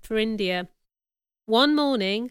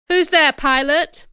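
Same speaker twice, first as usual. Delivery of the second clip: louder call into the rain; 5 semitones higher, 7 dB louder than usual. A narrator's reading. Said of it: "Mister Rochester cried".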